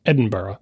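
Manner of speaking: Edinburgh ending in "buh-ruh"; schwa ending. Shown as a demonstration. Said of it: "not saying these with a Scottish accent".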